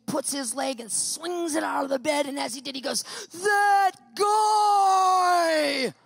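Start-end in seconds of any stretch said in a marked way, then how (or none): 3.3-5.9 shouted high and loud, furious. Retold or imitated. Husband waking up yelling at the emailer. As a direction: bellow it in outrage.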